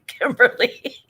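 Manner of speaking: high, loud name through laughter; laughing. Real, not acted. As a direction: crack up while calling out the name. Cracking up at a viewer's comment.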